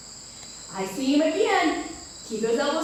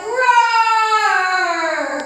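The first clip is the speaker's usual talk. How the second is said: loud drawn-out roar; lion roar; 7 semitones higher, 9 dB louder than usual. Shown as a demonstration.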